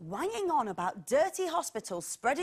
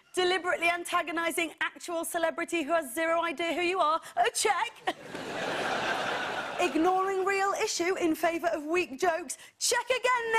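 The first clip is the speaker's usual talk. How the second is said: triumphant checklist; 6 semitones higher than usual. Acted. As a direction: crow each check proudly. Mock checklist read triumphantly.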